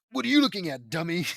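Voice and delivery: mock-menacing challenge, starting loud. A narrator's reading. JD challenging staring villagers, mildly menacing. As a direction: throw it out as a half-serious threat. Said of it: "I ask menacingly".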